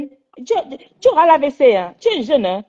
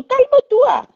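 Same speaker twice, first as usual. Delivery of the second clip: much higher, firm plea; pleading high; 10 semitones higher, much slower than usual. Real, not acted. Mother pleads with daughter to calm down, high and firm.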